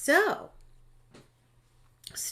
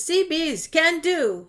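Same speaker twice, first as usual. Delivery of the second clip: higher, louder, drawn-out cheer; chat cheer; 6 semitones higher, 6 dB louder, slower than usual. Retold or imitated. Host reading Scott's chat message, slow loud cheer. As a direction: read it out as a slow rallying cry.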